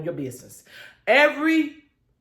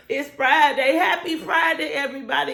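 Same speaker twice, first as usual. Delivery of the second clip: excited shout; 6 semitones higher than usual. Real, not acted. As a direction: joyfully yell the weekend in. Friday greeting, shouted repeat.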